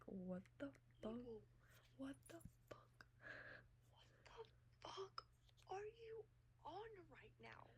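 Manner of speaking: high, hushed whisper of disbelief; stunned whisper. Retold or imitated. Asking him what he is on, stunned high whisper. Retold disbelief at his text.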